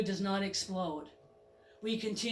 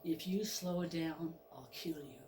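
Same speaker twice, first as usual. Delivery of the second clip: quiet threat; 5 semitones lower, 10 dB quieter than usual. Acted. Driver threatens the goat.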